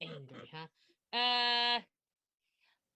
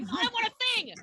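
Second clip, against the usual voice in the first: high, loud, greedy creature voice; grabby monster voice; 9 semitones higher, 5 dB louder than usual. Retold or imitated. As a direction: whine it like a greedy goblin. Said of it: "She's like".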